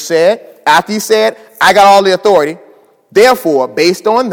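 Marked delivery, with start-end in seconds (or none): 1.6-2.6 loud, quick, emphatic claim; bold declaration. Acted. Preacher voicing Jesus to the congregation, loud and sure. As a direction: declare it with full confidence.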